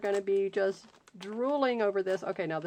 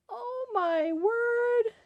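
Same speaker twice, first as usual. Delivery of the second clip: overwhelmed awe; 13 semitones higher, much slower than usual. Real, not acted. Overwhelmed by gift.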